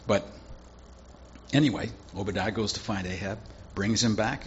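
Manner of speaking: usual speaking voice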